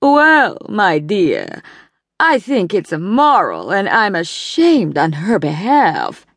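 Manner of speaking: disapproving Boston drawl, slow on the shame; a Boston society matron tutting over a scandal; low Boston drawl. A narrator's reading. Evelyn to the bridge ladies, in a prim Boston drawl. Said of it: "in her low Boston drawl".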